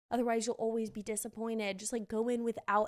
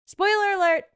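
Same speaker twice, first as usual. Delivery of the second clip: loud, high spoiler warning; sudden loud alert; called-out warning; 9 semitones higher, 11 dB louder than usual. Pretend. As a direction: call it out like an alarm. Warning listeners before plot reveal.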